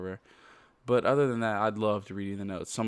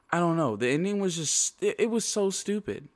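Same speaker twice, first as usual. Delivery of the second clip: higher, exasperated rant; 8 semitones higher than usual. Real, not acted. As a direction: throw your hands up at it. Ranting about the game's ending.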